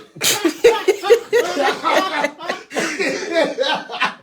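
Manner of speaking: loud, high-pitched cackling laugh; long, helpless burst of high laughter; laughing hard. Real, not acted. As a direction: crack up at your own voice. Cracking up at own accidental voice.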